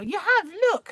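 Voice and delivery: put-on loud, high-pitched child insisting; booming child's shout. Son contradicting his embarrassed mother, booming loudly. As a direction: boom it out like a stubborn little boy. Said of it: "he had a big booming voice".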